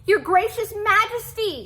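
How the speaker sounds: high, loud pleading cat voice; a small cat begging the king for help. A narrator's reading. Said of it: "begged for help".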